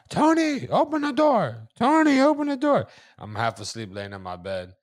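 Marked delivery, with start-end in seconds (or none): 0.1-2.9 high, loud call through the door; mom yelling. Retold or imitated. Mom yelling through the locked door at her son, high and loud.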